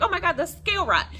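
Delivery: high, fast mock alarm; alarmed exclamation. Friend spots scale rot in a picture, retold alarm. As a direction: imitate a friend's startled discovery.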